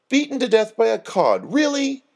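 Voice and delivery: high, disbelieving challenge rising on the last word; incredulous. A narrator's reading. Doreen challenging friends who believed the obituary, incredulous. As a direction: throw it at them as plainly absurd. Exasperated Doreen mocks the false report.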